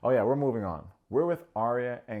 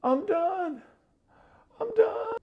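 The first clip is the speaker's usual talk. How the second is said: shrill mock screaming sobs, extremely high; hammed-up meltdown wail; mock crying scream; 5 dB louder, slower than usual. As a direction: imitate a grown man screaming and crying. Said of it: "crying on the couch, screaming".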